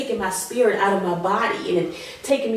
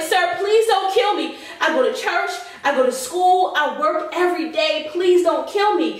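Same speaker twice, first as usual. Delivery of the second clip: high, pleading retold plea; begging the attacker in a raised voice; pleading for life; 8 semitones higher than usual. Woman retelling begging her attacker not to kill her, high pleading. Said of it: "I'm like".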